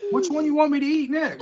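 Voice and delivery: high, loud laughing taunt; laughing tease. Real, not acted. Teasing after hot bean.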